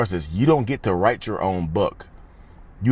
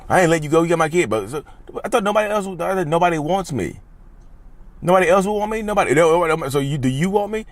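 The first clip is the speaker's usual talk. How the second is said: higher, mocking back-and-forth between partner and narcissist; mock quarrel voices; 6 semitones higher than usual. Mocking both partners in a toxic argument, higher and hammed-up. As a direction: ham up both sides of the fight.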